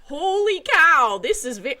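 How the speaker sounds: loud, slow holy cow; astonished. Retold or imitated. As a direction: realisation hitting hard. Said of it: "And so I was like".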